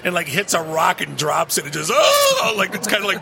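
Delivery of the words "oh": high, drawn-out mock wail of "oh"; imitated anguished cry. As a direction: cry out in exaggerated horror. Imitates friend's anguish at spilled beer.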